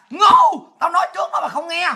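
loud, high one-word outburst; angry shout. Real, not acted. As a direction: yell the insult in exasperation. Scolds those who ignored her warning.

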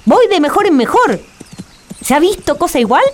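high, simple-minded fool; high silly Juan voice. Acted. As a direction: play the cheerful village fool. Juan boasts.

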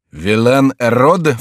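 slow, drawn-out cry; exclaiming. A narrator's reading. King hearing his name made an anagram, drawn-out exclamation. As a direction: stretch the name out in disbelief. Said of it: "вскричал король".

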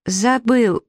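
small high-pitched mumble; mumbling prince. A narrator's reading. Prince forgets his line on stage, mumbling high. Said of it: "пробормотал принц".

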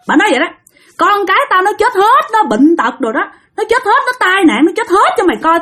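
high-pitched, emphatic retold curse; vengeful cursing oath. Sister-in-law swearing at the accused before the altar, vengeful cursing. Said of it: "giống như là bà nguyền rủa".

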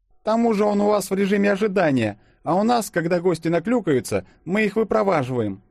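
high, insistent waitress voice; objecting waitress. A narrator's reading. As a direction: argue back in a high voice. (возражающая официантка, высоким голосом)

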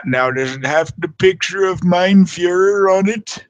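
high, slow mock customer voice; prank caller voice. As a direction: play a silly caller. Continuing prank caller bit.

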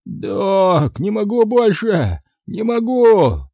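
high, slow anguished cry; half scream, half wheeze; wheezing cry. Acted. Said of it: "то ли закричал, то ли захрипел".

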